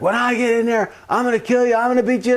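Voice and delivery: high, hammed-up angry threat; threatening. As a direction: imitate a furious brother threatening through a door. Brother threatens through the door.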